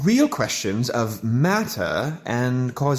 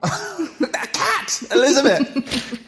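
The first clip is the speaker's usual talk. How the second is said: high, mocking laugh then exclaim; scoffing laugh; 10 semitones higher, slower than usual. Victor dismisses the cat to Elizabeth, laughing and high.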